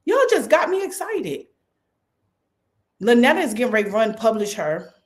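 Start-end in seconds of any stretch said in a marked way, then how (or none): 0.1-1.5 excited. Real, not acted. Pastor to congregation, higher excited repeat. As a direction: pitch jumps up as excitement breaks through.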